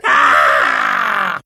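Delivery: loud deep cry of fright; a sudden low terrified shout; frightened scream. Acted. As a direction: yelp in terror as they turn. Narrator frightened by Furies.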